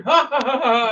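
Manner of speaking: a staged ha-ha in the dark; hammed-up laughing. Dragonborn laughing behind the curtain, mock laughter. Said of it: "you're doing".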